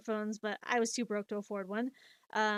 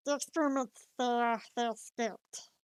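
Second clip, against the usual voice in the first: cartoon alien creature voice, slowing down; Stitch. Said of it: "Stitch, I don't know if you're".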